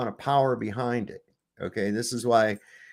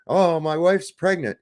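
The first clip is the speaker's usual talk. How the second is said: mock whiny voice; 7 semitones higher, 3 dB louder than usual.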